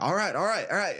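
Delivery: high, urgent mock pleading; imitated frantic surrender. Coach mimics opponent trying to tap, high mock panic.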